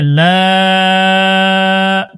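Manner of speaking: লা held very long on one note; three-alif madd. Shown as a demonstration. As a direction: stretch the aa of লা, steady and sustained. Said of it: "তিন আলিফ". (লা তিন আলিফ লম্বা টান)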